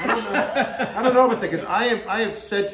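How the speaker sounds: amused protest, breaking into laughter; laughing. Real, not acted. Laughing off a flaw accusation.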